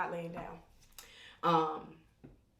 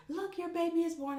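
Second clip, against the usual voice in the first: much higher, lightly voiced cheerful midwife; bright midwife voice; 11 semitones higher than usual. The midwife presenting the newborn, a lightly voiced cheerful tone. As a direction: offer it like good news, sing-songy. Midwife hands her the baby.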